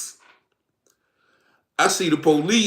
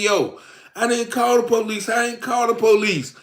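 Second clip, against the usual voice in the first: put-on girlfriend denying; 7 semitones higher than usual. Girlfriend denies, voiced higher.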